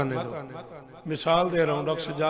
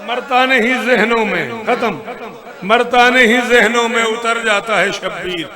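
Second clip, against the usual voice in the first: loud, ringing verse recitation; declaimed elegy; 2 semitones higher, 12 dB louder than usual. Acted. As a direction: proclaim the couplet to the congregation at full voice.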